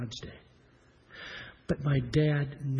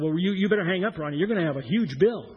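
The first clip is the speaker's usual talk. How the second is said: dad's voice; 5 semitones higher, 7 dB louder than usual. Retold or imitated. Dad urging son to hang up.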